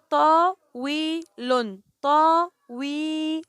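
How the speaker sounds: "طاويل" in separate, drawn-out syllables; slow teacher's demonstration of "طاويل". Shown as a demonstration. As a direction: hold each part of "طاويل" long, pausing between. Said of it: "ففي النطق بعرفها".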